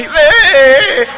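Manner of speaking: loud, high drawn-out yell; calling out. Retold or imitated. Imitating neighbors of a naked man, hollered.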